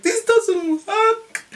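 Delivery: very high, loud, stretched-out shriek; comic high wail, words dragged out; mock frustrated scream. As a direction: re-enact screaming that it's useless. Repeated outburst over gas and air.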